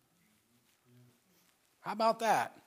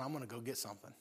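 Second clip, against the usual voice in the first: sly resolve; 7 semitones lower, 17 dB quieter, faster than usual. Acted. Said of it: "He's like".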